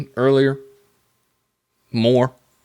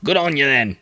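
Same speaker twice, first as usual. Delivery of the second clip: amateur Australian accent with a falling lilt; 3 semitones higher than usual. Shown as a demonstration. Showing off his accent skills, mock Australian. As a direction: have a playful go at sounding Australian. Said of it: "it's definitely Australian".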